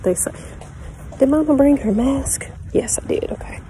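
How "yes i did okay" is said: whisper. Real, not acted. Answering softly about the mask.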